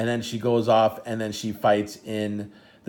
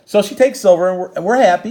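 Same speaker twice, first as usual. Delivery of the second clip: excited; 8 semitones higher, 9 dB louder than usual. Real, not acted. Happy telling of silver.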